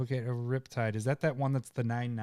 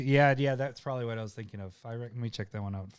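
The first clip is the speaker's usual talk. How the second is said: ordinary talk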